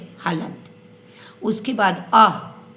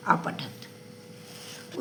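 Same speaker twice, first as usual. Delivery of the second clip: whispered form; 10 dB quieter, much slower than usual. Shown as a demonstration. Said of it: "teacher whispers form".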